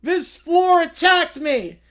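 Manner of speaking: loud, slow outraged cry; indignant bear yell. Acted. Little Brown Bear yells at the mat after tripping, outraged. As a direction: blame the floor at full volume. Bear narrated face-plant, then quote.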